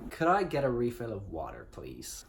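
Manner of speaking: Briton putting on an American accent; imitated American accent. Shown as a demonstration. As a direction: copy an American accent, carefully. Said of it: "in the exact same accent".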